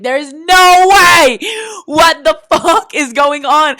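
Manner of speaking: slow, loud, high disbelieving yell; shocked shout. Retold or imitated. Finding the neighbor behind the next door, slow shocked shout. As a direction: yell it as the door swings open.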